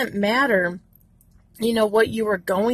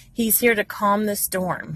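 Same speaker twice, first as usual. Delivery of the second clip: usual speaking voice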